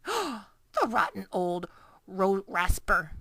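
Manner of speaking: slow, outraged giant cry; indignant cry. A narrator's reading. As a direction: spit out the insult slowly. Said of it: "cried the B F G".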